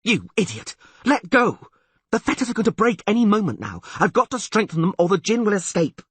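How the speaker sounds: high, loud urgent anger; frantic yell. A narrator's reading. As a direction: shout at him in panic. Yennefer struggles and yells.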